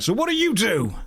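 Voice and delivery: put-on high, pushy drunk stranger voice; drunk chap. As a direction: barge in like a tipsy stranger on a train. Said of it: "just turns to me and is like".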